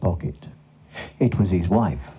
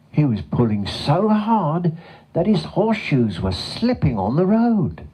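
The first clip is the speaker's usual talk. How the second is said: higher, animated storytelling; dramatic narration; 8 semitones higher than usual. A narrator's reading. Narrator telling of the horse's hard pulling, dramatic. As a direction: swoop up on the key words.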